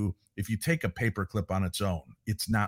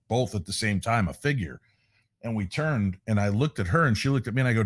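ordinary talk, nothing marked

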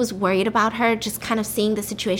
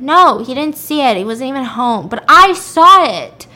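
acted exasperated insistence; 3 semitones higher, 8 dB louder than usual. Woman tells doubting police only she saw it, exasperated.

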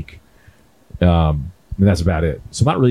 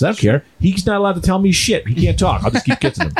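usual speaking voice

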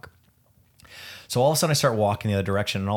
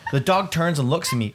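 ordinary talk, nothing marked

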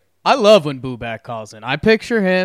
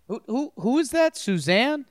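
Shaggy voice; 8 semitones higher than usual. Pretend. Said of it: "Shaggy and Scooby-Doo impersonation".

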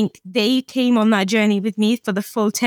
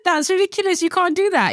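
mock protest; 9 semitones higher than usual. Husband objects to fake death.

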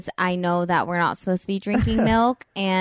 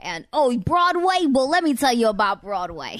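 higher, louder, laughing Long Island accent, imitated; 5 semitones higher, 4 dB louder than usual. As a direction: ham up a chatty Long Island man. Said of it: "they had this crazy accent".

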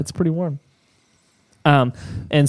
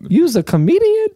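skeptical stranger; 3 dB louder than usual. Retold or imitated. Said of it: "Have you ever told that story".

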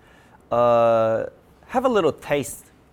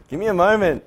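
normal talking voice

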